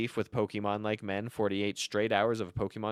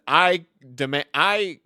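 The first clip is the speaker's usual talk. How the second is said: loud mock ruling; slow, emphatic pretend verdict; girlfriend playing judge; 3 semitones higher, 8 dB louder, slower than usual. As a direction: declare it like a judge with a gavel. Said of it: "hit her gavel and she said".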